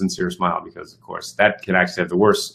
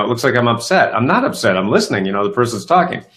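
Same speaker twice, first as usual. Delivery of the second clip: ordinary talk, nothing marked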